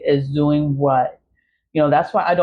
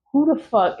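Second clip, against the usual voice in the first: exasperated who the fuck; 8 semitones higher than usual. Retold or imitated. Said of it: "I'm like".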